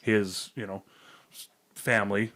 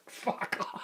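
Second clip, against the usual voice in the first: mock exasperation under breath; 9 dB quieter than usual. Retells muttering at the fly.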